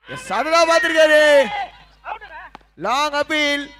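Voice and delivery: high, loud commentator's shout; excited cricket commentary, high and held; excited live call. Real, not acted. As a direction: shout out the appeal as it happens.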